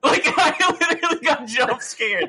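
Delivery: high-pitched, real laughter through the words; laughing. Real, not acted. Telling friend about getting jump scared, laughing. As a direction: crack up while telling it.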